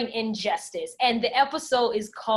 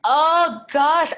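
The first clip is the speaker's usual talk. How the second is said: louder, higher rising oh gosh; flustered exclamation, higher and louder; exclaimed aloud; 7 semitones higher, 10 dB louder than usual. Real, not acted. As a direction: burst out with it, flustered by the question. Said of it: "Oh gosh (said again aloud)".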